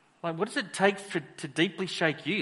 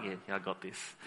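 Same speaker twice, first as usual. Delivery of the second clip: cocky brush-off; 8 semitones lower, 11 dB quieter than usual. Retold or imitated. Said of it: "He's like".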